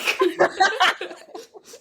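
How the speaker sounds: wild high ape howl; ape scream. Shown as a demonstration. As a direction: go full ape mode. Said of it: "I go ape mode".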